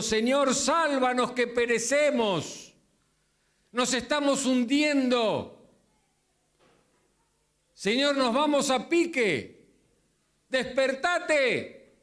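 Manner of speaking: high, pleading cries for help; panicked cry. Acted. Frightened disciples begging their sleeping master. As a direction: cry out as if the boat is sinking.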